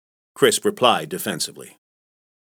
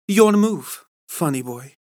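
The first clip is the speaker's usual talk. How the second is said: higher, playful dare; a touch of flirty woman; 6 semitones higher than usual. Acted. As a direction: pulls him close and teases. Woman grabs his tie.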